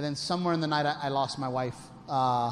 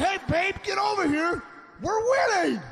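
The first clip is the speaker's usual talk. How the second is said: high-pitched, gleeful holler; excited calling out; 13 semitones higher, 3 dB louder than usual. Retold or imitated. Husband to angry wife at the table, high excited call. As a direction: call her over, oblivious and thrilled.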